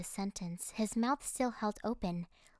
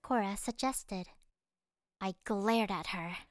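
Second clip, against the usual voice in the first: plain reading voice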